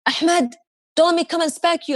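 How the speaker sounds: a high, mock-stern grandpa warning; grandpa scolding. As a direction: tease the grandson with a playful threat. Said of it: "He even shouts at my kids".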